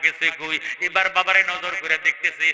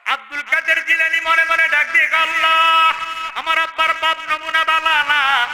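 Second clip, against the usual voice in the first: high, loud chanted appeal; full-force preaching; 11 semitones higher, 6 dB louder than usual. Acted. Preacher voicing the boy's appeal to Allah, high chanted preaching. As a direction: cry out to God on a sustained high note.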